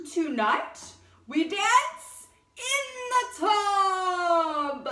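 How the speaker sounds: loud, high, slow royal proclamation; booming king. A narrator's reading. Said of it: "cried the king".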